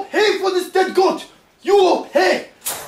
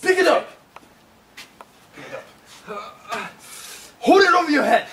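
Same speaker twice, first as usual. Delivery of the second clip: barking angry guard; 4 semitones higher than usual. Acted. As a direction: bark commands at the prisoner. Guard barks orders at the prisoner.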